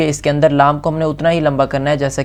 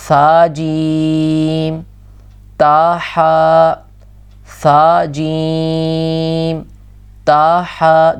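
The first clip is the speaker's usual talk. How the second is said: slow recitation; much slower than usual. Shown as a demonstration. Teacher drills letter names.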